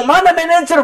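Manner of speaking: high-pitched mock outburst; retold angry demand. Passenger demands the manager at airport, retold angry demand. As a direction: act out an irate passenger's demand. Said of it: "Dia marah, dia ngomong".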